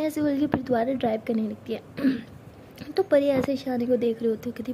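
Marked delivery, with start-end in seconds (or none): none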